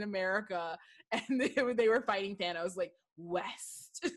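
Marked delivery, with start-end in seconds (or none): 3.2-4.1 low, softer, deadly-serious mock line; hushed hero's mock last stand; epic quiet line delivery. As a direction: deliver it like the final battle. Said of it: "delivered that West line like it was her end game".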